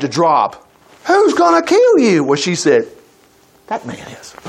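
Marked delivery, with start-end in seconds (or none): 1.0-2.2 high, slow, startled question; the king's jaw drops; astonished outburst, much higher; shocked king. Acted. King reacts to Esther's plea. 3.7-4.3 hushed, secret accusation; breathy whispered reveal. Acted. Esther names her enemy.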